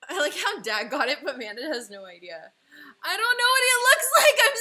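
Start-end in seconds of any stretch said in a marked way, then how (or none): 3.0-4.6 high, loud and fast through laughter; laughing. Real, not acted. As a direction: laugh while blurting it. Laughing at partner's drawing.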